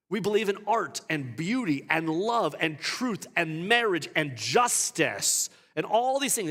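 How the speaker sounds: loud, high, driving sermon voice; preaching at full force. Acted. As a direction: preach it hard to the congregation.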